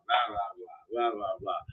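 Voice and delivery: soft, low dismissive blah blah; mocking blah blah. Caller dismissing, mocked.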